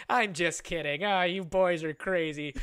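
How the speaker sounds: loud, theatrical mock scolding turned playful; put-on joking act. Aunt revealing her prank to the boys, put-on joking act. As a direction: ham it up as a big performance. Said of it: "this lady put on the fucking performance".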